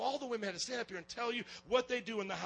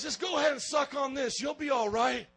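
louder, brushing it off with a lozenge; a mock-tough mother shrugging off her hurt son; dismissive mom; 5 semitones higher, 8 dB louder than usual. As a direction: hand it over like it's nothing. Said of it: "She goes".

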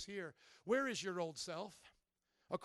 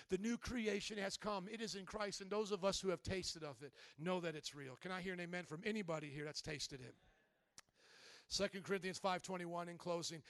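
ordinary talk, nothing marked

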